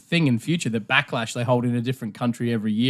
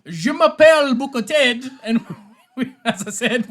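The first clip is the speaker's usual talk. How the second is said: laughing; 10 semitones higher, 5 dB louder than usual. Acted. Host laughing through his mock French.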